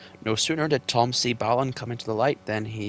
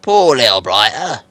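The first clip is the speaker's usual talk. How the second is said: loud, slow troll; 15 dB louder than usual. Acted. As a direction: pity it like a troll. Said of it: "said William".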